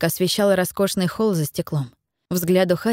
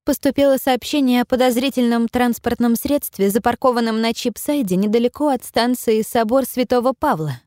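dispatcher calling over the radio; crackly radio call, raised; over walkie talkie; 4 semitones higher than usual. Acted. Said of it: "В этот раз голос женский".

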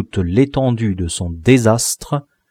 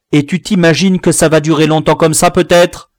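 loud, fast, high scolding; rapid-fire parental reproach; angry sarcasm; 6 semitones higher, 5 dB louder than usual. A narrator's reading. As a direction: challenge the boy rapidly, voice raised. Father resumes tirade.

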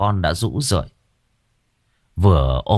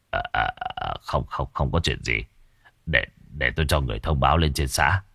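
low, hushed, halting reply; shaken stammer; 6 semitones lower, 6 dB quieter than usual. A narrator's reading. Village chief hiding his fright from villagers, low stammer. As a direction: stutter softly, trying to brush it off.

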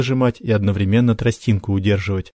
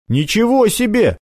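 exclaiming; 12 semitones higher than usual. Acted. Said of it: "воскликнул Макар".

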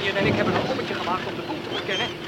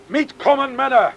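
put-on loud call to the men in a German accent; 10 dB louder than usual. Steersman loudly calls his men to follow. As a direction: call the crew to come along.

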